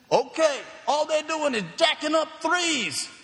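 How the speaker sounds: high, loud mimicked heckle; calling out. Rival parent giving away the team's strategy, calling out. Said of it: "they call out McMeans' strategy".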